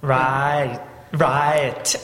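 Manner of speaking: "Right" said as "Ra" then "It"; trying the Yorkshire Right. Shown as a demonstration. Actor tries the coach's Yorkshire Right on request. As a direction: try "Right" the new way, slowly. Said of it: "So try that".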